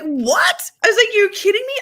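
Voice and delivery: loud, high mock outrage; retold incredulity. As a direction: act out stunned disbelief, voice leaping up. Retells her incredulous reaction to friend.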